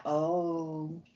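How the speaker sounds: soft, low, dismayed realization; a quiet sinking oh no. Retold or imitated. Rewatching a childhood film, quietly dismayed.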